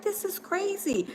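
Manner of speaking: a shopper muttering to herself; muttered disbelief. Retold or imitated. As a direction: say it half to yourself. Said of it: "I was like".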